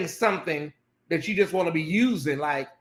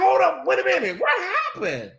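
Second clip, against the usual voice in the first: much higher, thrown hold up; stunned disbelief; 10 semitones higher than usual. Retold or imitated. Host reliving the pastor's threat, stunned. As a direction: play being caught off guard by a threat.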